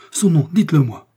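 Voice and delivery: loud, high demand; urgent cry. A narrator's reading. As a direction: insist desperately. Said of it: "s'écria Felton".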